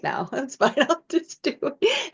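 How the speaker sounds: laughing through a breezy yes; laughing acceptance. Retold or imitated. Telling the director she'll do it unread, laughing. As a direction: chuckle and wave it off.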